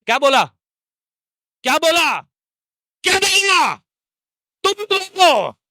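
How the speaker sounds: high, rising demand to the crowd; building shout. Real, not acted. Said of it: "चिल्लाया". (चिल्लाकर जोश भरते हुए)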